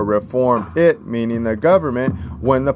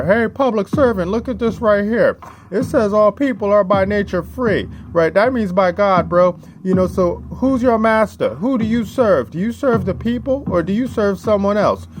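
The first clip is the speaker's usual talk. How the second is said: high, pointed challenge to an official; confronting a public servant; 10 semitones higher than usual. Acted. Speaker confronting a public servant, high mocking challenge. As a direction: lecture the servant who forgot his place, voice pitched up. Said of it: "you have to show them right here".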